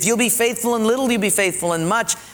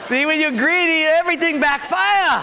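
laughing punchline; 7 semitones higher, 3 dB louder than usual. Real, not acted.

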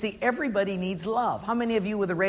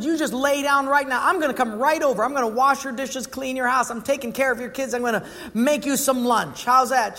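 higher, bright take-charge offer; mildly raised voice of a kind friend taking over; eager helper; 6 semitones higher, 3 dB louder than usual. Retold or imitated. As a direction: rush in to rescue a friend. Caller offering help.

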